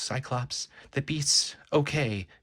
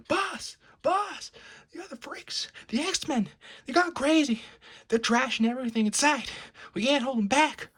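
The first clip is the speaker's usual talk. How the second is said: panicked yelling. Acted. Frightened henchman yelling to his boss about the X-Men. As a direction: burst in screaming for help.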